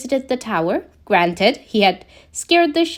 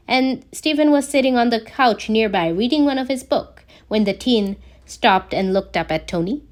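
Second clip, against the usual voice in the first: ordinary delivery, nothing marked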